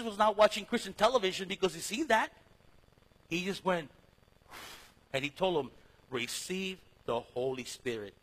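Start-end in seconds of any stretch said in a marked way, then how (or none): 6.1-8.1 hushed quote. Shown as a demonstration. Quoting Jesus again.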